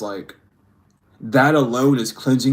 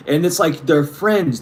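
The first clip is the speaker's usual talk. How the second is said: normal talking voice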